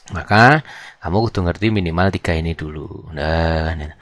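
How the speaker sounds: ordinary talk, nothing marked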